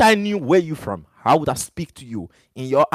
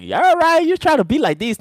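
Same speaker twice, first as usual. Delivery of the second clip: high, loud mocking voice of critics; mocking imitation; 12 semitones higher, 3 dB louder than usual. To people pushing him to change, mocking their high sneer. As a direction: squeak out a sneering parody of people who want him to change.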